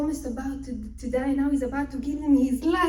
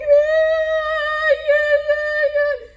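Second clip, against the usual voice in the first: high, loud, drawn-out wail; mock crying; 10 dB louder, much slower than usual. Wife with head on the table, wailing. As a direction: bawl it out in hammed-up sobs. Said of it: "She starts crying".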